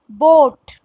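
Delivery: ordinary delivery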